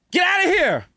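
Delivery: high hammed-up yell at the rats; mock yell. Exterminator yelling at the rats, hammed-up high shout. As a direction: shoo the rats off with a squeaky holler.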